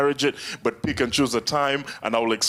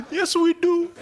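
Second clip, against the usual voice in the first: mock eager answer; slower than usual. Comic replaying his answer to his girlfriend, very high and eager. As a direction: squeak it out proudly.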